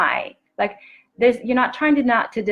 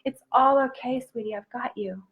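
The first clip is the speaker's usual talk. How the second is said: much softer, hushed and tender, trailing to near silence; soft reassurance; 3 semitones higher, 5 dB quieter than usual. Acted. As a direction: comfort a frightened little girl. Comforting inner child, softly reassuring.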